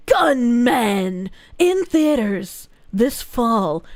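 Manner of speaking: low, slow trailer narrator; movie trailer voice. Acted. Streamer reading a chat message as a film promo, movie trailer voice. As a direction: intone it like a blockbuster trailer, drawn out. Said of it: "like, a movie trailer".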